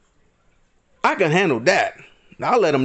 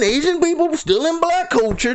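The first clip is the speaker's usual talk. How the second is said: mocking high voice; 11 semitones higher than usual.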